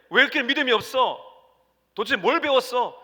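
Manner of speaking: loud, high scolding of the disciples; harsh rebuke. Retold or imitated. Imagined Jesus rebuking disciples, a loud harsh scolding. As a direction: bark at them like a stern master. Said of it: "그렇게 말하지 않았습니다".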